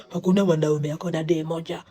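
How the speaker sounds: lightly voiced boastful man; mocking the men. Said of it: "the same men who say".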